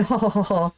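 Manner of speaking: mock "oh-ho-ho" chuckle; imitated laughing. He says it's up to him, imitated laugh. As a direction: act out his laugh after the line. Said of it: "like, start laughing".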